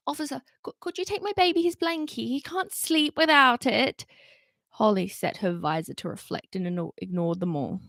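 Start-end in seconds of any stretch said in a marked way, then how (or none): 0.1-4.1 pleading mother. Acted. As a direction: plead with the officer for your baby. Begging parent at the station.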